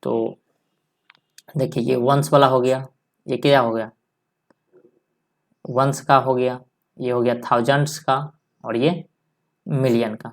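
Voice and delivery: normal talking voice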